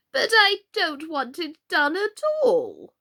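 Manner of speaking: a high, loud, slow groaning complaint; groaned. A narrator's reading. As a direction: moan it out like a fussy queen.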